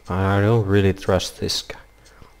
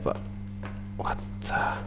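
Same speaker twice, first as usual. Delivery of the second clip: whispering; 14 dB quieter than usual. Real, not acted. Whispering in a scary game.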